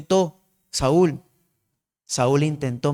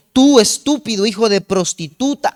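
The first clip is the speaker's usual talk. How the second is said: raised angry curse; furious insult; 4 semitones higher, 5 dB louder than usual. Retold or imitated. Saúl furious at Jonathan, cursing him. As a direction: hurl the insult at your son. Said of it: "se puso bien furioso".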